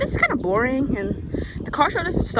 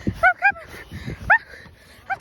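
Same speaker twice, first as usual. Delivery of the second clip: high chase voice. Pretend. Still chasing, high voice.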